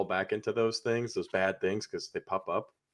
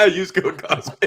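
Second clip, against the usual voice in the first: laughing; 8 semitones higher, 7 dB louder, slower than usual. Real, not acted. Joke ad read.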